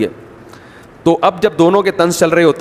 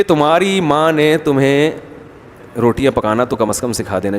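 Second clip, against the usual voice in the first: very calm taunt. Retold or imitated. Mother-in-law faulting the bride's mother, very calmly. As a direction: imitate an unhurried, gentle-seeming dig. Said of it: "لیکن بڑے آرام سے".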